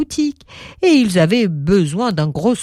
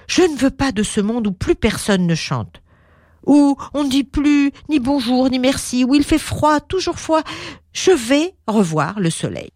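put-on boy's voice; 7 semitones higher than usual. Boy Pierre voiced in story.

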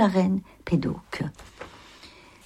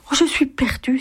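high, lightly voiced dismay; acted distressed cry; 7 semitones higher than usual. A narrator's reading. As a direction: play a poor girl's mild panic. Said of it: "s'écria la pauvre fille".

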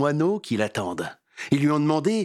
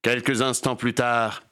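ordinary delivery

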